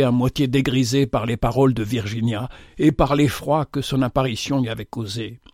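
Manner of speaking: plain reading voice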